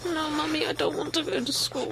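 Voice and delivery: put-on small childish voice pleading with mummy; whiny child. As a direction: play a kid begging not to go. Acted child refusing school.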